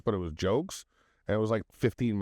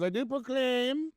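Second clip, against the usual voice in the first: high, slow, grand "I do proclaim"; hammed-up royal announcement; mock proclamation; 14 semitones higher, slower than usual. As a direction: proclaim it like a herald.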